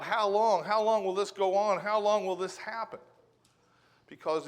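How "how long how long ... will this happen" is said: raised, pleading cry of how long; crying out to God. Retold or imitated. Prophet crying out to God, raised and pleading. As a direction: call out to God, higher and louder, desperate. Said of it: "he cries out to God".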